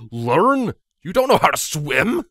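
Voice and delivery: can't believe what he just heard; incredulous. A narrator's reading. Vladimir to Broadsword after the swimming news, loud disbelief. Shocked friend after choking.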